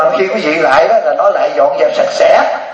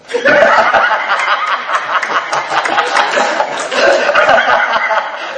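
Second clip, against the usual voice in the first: long hearty laugh; 6 semitones higher than usual. Real, not acted. Monk laughing at his own joke.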